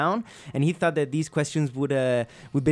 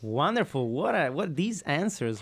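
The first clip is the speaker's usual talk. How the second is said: usual speaking voice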